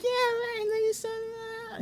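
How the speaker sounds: very high squawking mock phone voice. Friend turning away mid-call to someone else, very high mock chatter. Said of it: "the type of person where".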